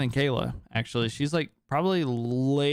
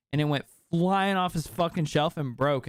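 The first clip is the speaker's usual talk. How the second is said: ordinary talk, nothing marked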